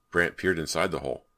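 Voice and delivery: usual speaking voice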